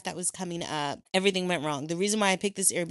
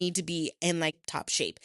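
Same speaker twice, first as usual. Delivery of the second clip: ordinary delivery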